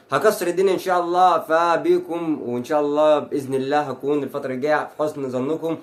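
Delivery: normal talking voice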